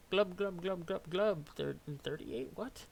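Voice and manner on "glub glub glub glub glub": gurgly fishy glubbing; gurgling fish man croak. Acted. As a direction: glub it out like a fish troll. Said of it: "I can't really control the glubs".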